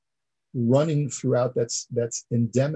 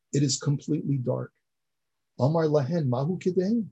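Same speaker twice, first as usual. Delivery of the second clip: usual speaking voice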